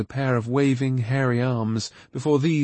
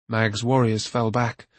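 usual speaking voice

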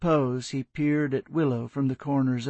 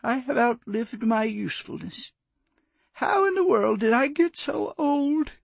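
put-on higher, quavering old woman near tears; mother mourning her son, voice breaking; 10 semitones higher than usual. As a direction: let the voice crack with grief. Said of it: "Willow's lip quivered and her voice broke".

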